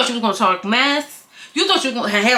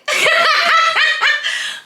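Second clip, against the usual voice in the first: high laugh. Real, not acted. Laugh at end.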